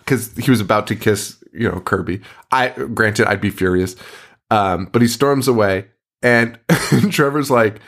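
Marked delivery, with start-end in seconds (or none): none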